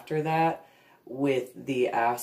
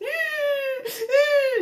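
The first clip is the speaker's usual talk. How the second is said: high, loud laughing sob; real teary laughter; crying laugh; 4 dB louder than usual. Real, not acted. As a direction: laugh until it cracks into tears.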